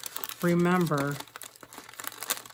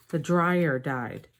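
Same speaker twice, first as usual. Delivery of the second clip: "dryer" stressed, slowed, correcting herself; slower than usual. Real, not acted. Correcting her slip to viewers, "dryer" stressed. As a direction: lean on "dryer", slow and firm. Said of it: "I mean, excuse me, not the washer".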